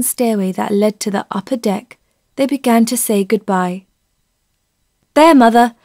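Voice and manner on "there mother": a touch louder father voice; the father handing over luggage, a bit louder; father's voice. A narrator's reading. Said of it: "said Fenella's father".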